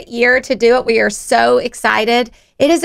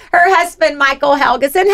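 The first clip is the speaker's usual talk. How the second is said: usual speaking voice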